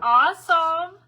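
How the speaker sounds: a big happy squeal; excited. Real, not acted. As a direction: burst out with delight. Reacting to the viewer comment.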